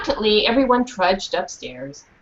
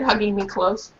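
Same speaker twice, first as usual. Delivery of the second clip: ordinary talk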